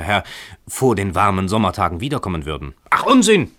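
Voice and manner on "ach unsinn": much higher and louder, exploding; furious shout. A narrator's reading. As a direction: blast it up the stairwell. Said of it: "schrie jener".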